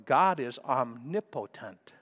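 ordinary talk

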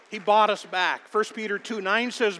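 loud, high fiery preaching. Acted. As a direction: preach it out to the congregation. Preaching at full force.

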